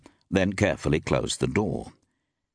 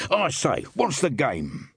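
sharp, higher mock snarl; near snarl; 8 semitones higher, slower than usual. Landlord turning on Temple, a near snarl. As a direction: bristle at Temple, almost snarling. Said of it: "he almost snarled".

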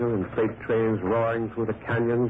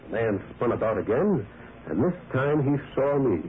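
ordinary delivery

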